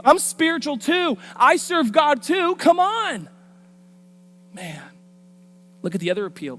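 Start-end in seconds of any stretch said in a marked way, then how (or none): 0.0-3.3 high, loud, hammed-up insistence; mock bold-faced protest. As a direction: insist brazenly, pitch way up. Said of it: "With bold face".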